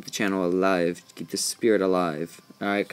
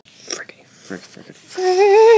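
unvoiced hissed frick; frustrated whisper; 10 dB louder, much slower than usual. Real, not acted. Frustrated at falling.

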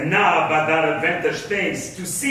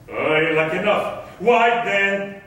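ordinary talk

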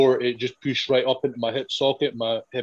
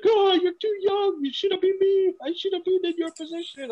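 high, wailing mock sob of a crying friend; much higher, teary mock blubbering; imitating crying Johnny. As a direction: ham up a tearful drunk pleading. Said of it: "he's crying".

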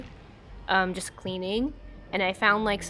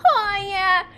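TikTok meme voice; 11 semitones higher, 5 dB louder than usual. Retold or imitated. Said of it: "meme on TikTok where people go like".

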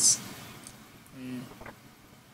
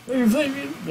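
wacky ad voice; 10 semitones higher than usual. Pretend. Hammy ad pitch on request.

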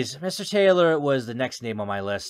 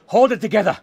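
louder, higher burst of panic; higher, forceful self-talk; frantic self-command; 10 semitones higher, 7 dB louder than usual. Acted. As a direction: urge yourself to keep it together, raised voice. Repeats the self-command more frantically.

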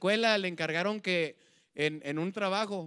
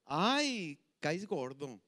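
normal talking voice

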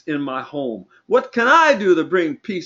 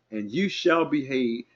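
normal talking voice

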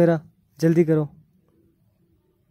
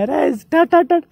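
much higher, tripping over the words; excited spluttering; 10 semitones higher, 5 dB louder than usual. Real, not acted. Mock stuttering excitedly. (उत्साह में हकलाते हुए)

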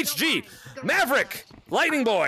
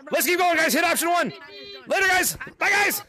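calling out; 7 semitones higher than usual. Real, not acted. Calling out to lobby players.

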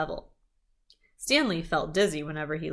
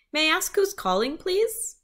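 put-on polite boy voice; 10 semitones higher than usual. Boy asks caller politely.

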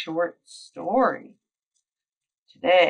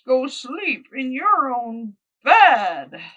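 high horse voice; 7 semitones higher, 8 dB louder, slower than usual. Acted. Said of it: "whinnied Horse".

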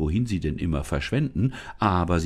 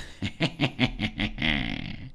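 gloating laugh; 2 semitones lower, 4 dB quieter than usual. Acted. Changeling laughs at the helpless parents, gloating. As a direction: laugh like a changeling who has won. Creature gloats after threat.

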